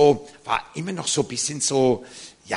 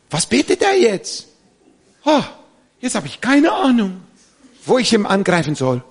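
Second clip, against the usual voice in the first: high, loud mock panic; panicked devil; 8 semitones higher, 6 dB louder than usual. The devil cannot understand the prayer, mock panic. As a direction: play the baffled enemy, pitched up and frantic. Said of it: "das muss für ihn schrecklich sein".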